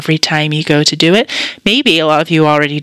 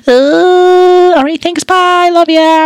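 high, bright sing-song farewell; cheery goodbye; 12 semitones higher, 5 dB louder, much slower than usual. Real, not acted. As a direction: chirp a goodbye to listeners. Cheery sign-off to listeners.

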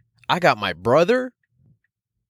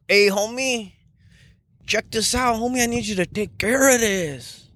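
put-on a light Mexican gangster accent; a hint of a Mexican accent giving orders; 6 semitones higher than usual. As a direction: play a homie in a Mexican accent. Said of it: "I love doing a Mexican accent".

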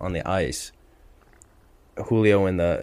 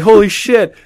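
mock shocked exclamation; 12 semitones higher, 11 dB louder than usual. Retold shock.

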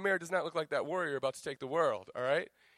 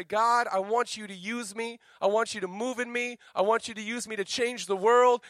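loud, higher, pleading cry; mock earnest prayer; 6 semitones higher, 7 dB louder than usual. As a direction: pray it big and showy. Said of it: "we sit there and we say".

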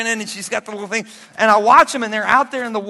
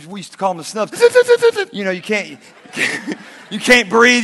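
usual speaking voice

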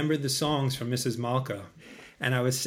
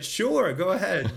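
high, light mock mom voice; mother's easy yes; 6 semitones higher, slower than usual. Mother on the phone, glad to let him go, high breezy voice. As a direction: toss off the yes like a relieved mother.

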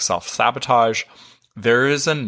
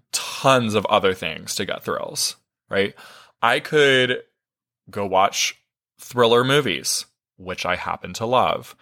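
ordinary talk, nothing marked

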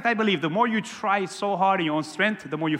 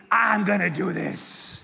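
mock determination; slower than usual. Mocking determined self-willed resolution makers.

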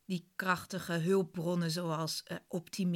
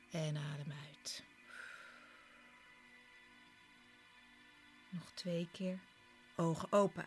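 guided meditation. Acted. Leads a guided relaxation.

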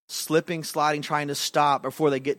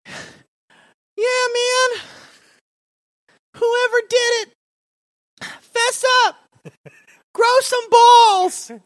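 very high, very loud yell, broken into bursts; panting kid's shout; 12 dB louder, much slower than usual. Retold or imitated. Winded seventh grader demanding a confession from the lineup, imitated shout. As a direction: holler it from the back, drawing out the last words. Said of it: "he's panting, and he goes".

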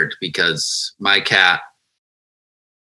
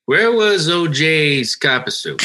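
imitating dad with a slow, higher Boston accent; dad's Boston accent; 7 semitones higher, slower than usual. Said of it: "My parents have a Boston accent".

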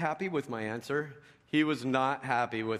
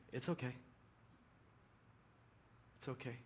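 much softer, gentle soothing; soft reassurance; 15 dB quieter, faster than usual. Retold or imitated. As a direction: hush it down like calming a child. Repeating speaker's soothing words.

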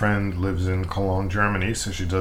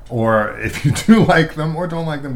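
laughing; 7 semitones higher, 5 dB louder than usual. Real, not acted.

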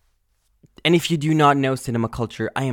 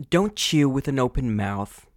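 ordinary talk

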